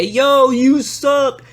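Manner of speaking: high, drawn-out mock jeer; hammed-up audience taunting; heckler. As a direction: heckle from the crowd in a high, loud voice. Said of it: "anybody could say".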